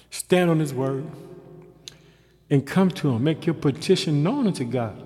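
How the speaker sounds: loud, rising preacher's cadence; fervent sermon delivery; preaching. Acted. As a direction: preach it with full force. Preaching at full force.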